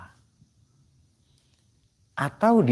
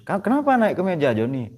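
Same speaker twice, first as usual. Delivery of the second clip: doctor; 6 semitones higher than usual. Retold or imitated. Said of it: "Kata dokternya".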